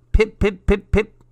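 high, loud payphone pips; imitated phone pips. A narrator's reading. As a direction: mimic the beeps in short, high bursts. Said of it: "the pips would go".

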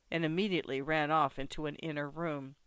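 plain reading voice

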